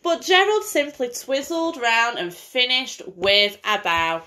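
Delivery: lively bedtime story reading; singsong picture-book voice for a little girl; reading to a child. Acted. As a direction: read it like a storybook to a small child. Mother reads picture book aloud.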